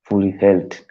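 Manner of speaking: ordinary delivery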